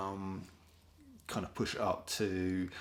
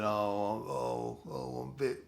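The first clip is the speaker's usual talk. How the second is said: mock sick voice; 4 semitones higher, 3 dB quieter, faster than usual. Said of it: "rang up your boss and said".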